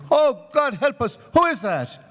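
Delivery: high, startled "who is that"; a man startled by a voice in the dark. Acted. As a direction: jump at a voice in the dark.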